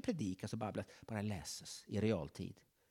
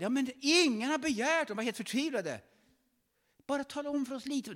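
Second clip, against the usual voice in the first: much higher, pleading voices; desperate plea; 14 semitones higher, 6 dB louder than usual. Acted. Wise men beg the king, mildly panicked.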